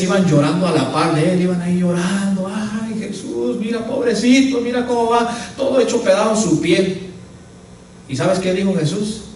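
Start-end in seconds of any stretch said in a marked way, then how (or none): 2.5-7.1 mock the pitying women, lamenting. Mujeres llorando junto a Jesús. (lamento burlón de mujeres compasivas, más agudo y suave)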